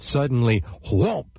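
ordinary delivery

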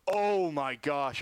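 slow, stunned oh my gosh; real horror at what he just saw; shocked gasp. Real, not acted. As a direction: broadcaster reacting live to a bad injury. Sees a player badly hurt.

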